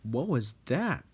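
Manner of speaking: ordinary delivery, nothing marked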